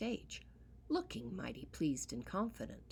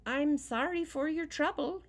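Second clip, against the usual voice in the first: put-on wicked boy; 10 semitones higher, 8 dB louder than usual. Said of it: "he said to old Pat".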